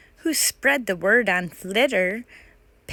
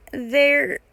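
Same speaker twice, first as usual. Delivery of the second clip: nervous hesitation; 5 semitones higher, 5 dB louder than usual. Acted. Pippa hesitating over lie.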